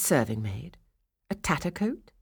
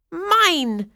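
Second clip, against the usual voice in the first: higher and louder spiteful cry; spat back; 14 semitones higher, 8 dB louder than usual. Acted. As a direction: snap it back greedily. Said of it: "Zlayuka spat back".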